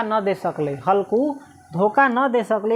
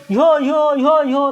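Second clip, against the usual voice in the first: louder, high-pitched herding cry; shooing call; 4 semitones higher, 5 dB louder than usual. Retold or imitated. Village men crying out to drive animals off the fields, loud. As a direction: chase the animals off with a yell. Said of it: "अपने इधर के आदमी कथी कहै छै".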